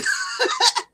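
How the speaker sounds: short high-pitched giggle; bright little laugh at your own song. Real, not acted. Laughing after praising her song.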